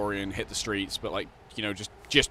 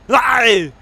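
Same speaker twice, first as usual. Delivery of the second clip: loud high shout; 11 semitones higher, 15 dB louder than usual. Real, not acted. Gamer calls out his friend's lie, loud shout. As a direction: yell it out.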